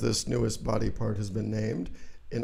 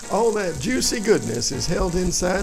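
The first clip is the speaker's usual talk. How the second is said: folksy ad pitchman; 4 semitones higher than usual. Acted. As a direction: sell sausages like a cheery TV spokesman. Sausage ad pitchman.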